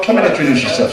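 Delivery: normal talking voice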